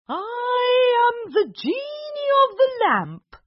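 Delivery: high, slow, loud proclamation; booming genie. Acted. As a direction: announce yourself grandly. Genie announces himself.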